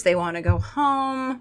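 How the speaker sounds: ordinary delivery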